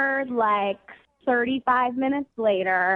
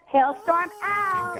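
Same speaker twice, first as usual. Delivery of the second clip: sign-off catchphrase; 7 semitones higher, slower than usual. Real, not acted. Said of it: "you say that all the time".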